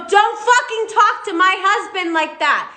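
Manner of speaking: high, heated warning; retold angry yelling. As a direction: snap at him to leave her husband alone. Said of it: "And I go".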